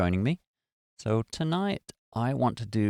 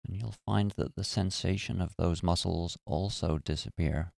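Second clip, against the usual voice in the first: calm, even meditation voice with long pauses; guided meditation. Acted. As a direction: guide listeners gently through relaxing the body. Leads a guided body-relaxation meditation.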